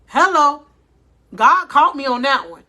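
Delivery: ordinary delivery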